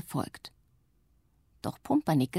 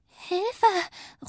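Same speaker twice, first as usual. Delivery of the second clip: very high, frightened squeal; cry for help; 14 semitones higher than usual. A narrator's reading. Little witch clings to her sister on the sledge, panicked cry. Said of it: "ruft Zuckerguss".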